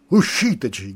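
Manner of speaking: urlato forte e secco; grido. Acted. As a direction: grida l'ordine al traghetto. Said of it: "gridò". (il nocchiero grida forte)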